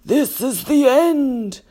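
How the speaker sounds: slow, high wail of doom; crying out. A narrator's reading. Grasshopper despairs as the peach plummets, doom-laden cry. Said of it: "cried the old green grasshopper".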